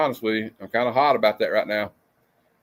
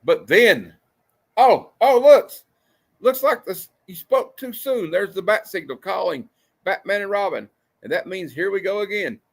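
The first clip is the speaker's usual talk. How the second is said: excited; 7 semitones higher, 3 dB louder than usual. Real, not acted. Excited reading of panel.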